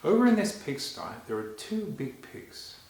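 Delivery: ordinary delivery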